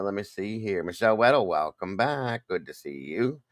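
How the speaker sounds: normal talking voice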